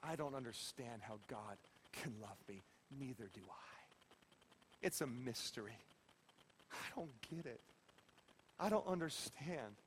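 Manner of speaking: put-on a small girl's hushed admission of guilt; hushed, near-whispered confession. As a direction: confess it quietly, voice dropping away. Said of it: "she looked at Brad and said".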